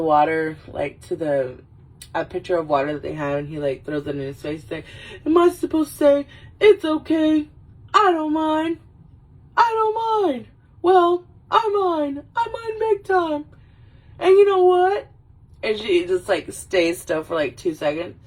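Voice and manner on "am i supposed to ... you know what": high, hammed-up anguish; high-pitched mock tirade, stretched toward the end; melodramatic speech. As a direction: wail it like an overacted Oscar scene. Wayne's big overacted speech continues.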